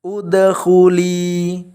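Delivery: udkhuli, slow, the final li held for two beats; udkhuli with long li. Shown as a demonstration. Teacher modelling the long ending of udkhuli, slowly. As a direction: hold the li of udkhuli for two counts. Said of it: "dibaca dua harokat".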